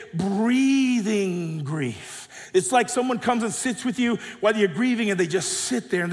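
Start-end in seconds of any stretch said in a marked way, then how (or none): 0.1-2.5 stretched out very slowly; drawn-out emphasis. Acted. Preacher voices the Hebrew rhythm of comfort, drawn out. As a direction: draw each word out like a long breath. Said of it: "almost as if to say".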